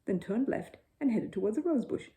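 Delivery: usual speaking voice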